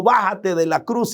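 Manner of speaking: ordinary delivery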